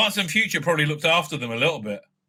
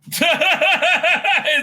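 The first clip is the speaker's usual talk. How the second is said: high laugh bursting out; loud burst of laughter; 10 semitones higher, 5 dB louder than usual. Real, not acted. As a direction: crack up loudly at the joke. Laughing at own verdict.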